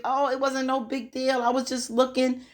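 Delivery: mock him brushing it off as no big deal; mock nonchalance. Recapper mimicking the boyfriend shrugging off his spying, high mocking nonchalance. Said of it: "he acted as if".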